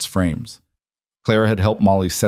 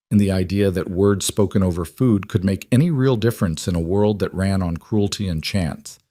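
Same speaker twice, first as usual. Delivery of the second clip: ordinary delivery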